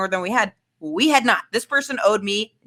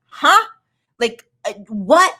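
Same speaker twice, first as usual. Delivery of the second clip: shocked huh; slower than usual. Retold or imitated. Retold disbelief at being dumped.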